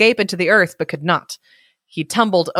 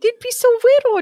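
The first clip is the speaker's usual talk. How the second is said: very high cooing over something tiny. Real, not acted.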